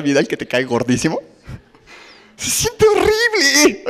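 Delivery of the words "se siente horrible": high, slow, hammed-up whimper; mock whine. Preacher joking about honoring someone you dislike, whiny.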